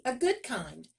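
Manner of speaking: high, eager sales pitch; eager boasting, building to a loud, high finish; boastful miller. A narrator's reading. Miller boasts of his daughter.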